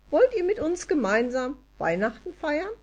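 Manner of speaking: high little elf voice; Elida. A narrator's reading. Wood elf invites Anja and dog to Christmas, high elf voice. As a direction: invite them in a high elfin pitch. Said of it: "fuhr Elida eilig fort".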